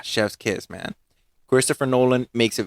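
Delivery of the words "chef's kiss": ordinary talk, nothing marked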